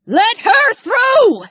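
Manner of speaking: high, slow, stretched-out yell; shouted. A narrator's reading. Jess orders the guards to clear the way, shouting. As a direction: bellow an order across the distance. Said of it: "Jess shouted".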